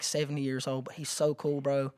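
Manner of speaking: ordinary talk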